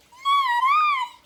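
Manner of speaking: very high, loud squeak; squeaky mouse. A narrator's reading. Mouse refusing to help the hen, high squeaky voice. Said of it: "said the mouse".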